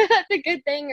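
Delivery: a laugh breaking into the words. Real, not acted. Guest laughing off the boyfriend question, laughing. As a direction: laugh it off with a giggle. Guest embarrassed about guy friends.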